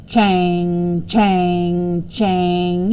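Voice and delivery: clang it out like a machine. A narrator's reading. Narrator mimicking the clanging machine next door. Machine noise read aloud.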